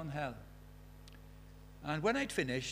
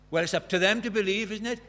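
louder, brushing-off lady voice; the old lady protesting, a bit louder; Deirdre objecting; 4 semitones higher, 7 dB louder than usual. Retold or imitated. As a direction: wave it away defensively.

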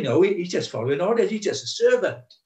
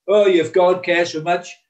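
louder, higher stroppy heckle; mock student heckle, raised; heckling shout; 4 semitones higher, 6 dB louder than usual. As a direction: jeer like a rude student. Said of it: "shouting out".

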